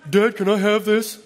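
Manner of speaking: put-on a bolder, older kid's request; teen's changed voice. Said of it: "Notice the voice changed".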